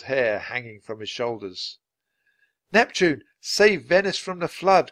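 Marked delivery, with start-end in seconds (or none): 2.7-4.9 high, loud plea; urgent raised appeal; crying out. A narrator's reading. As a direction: implore a towering god over the storm. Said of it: "cried Neptune".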